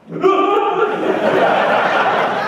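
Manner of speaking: very high, loud breathy burst of laughter; relieved laugh. Retold or imitated. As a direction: laugh out the release of pure panic. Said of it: "I was freaking out".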